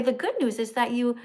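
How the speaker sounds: ordinary delivery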